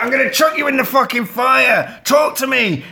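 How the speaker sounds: high, loud threatening yell; furious threat. Retold or imitated. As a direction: scream the threat at the doll. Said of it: "she's like".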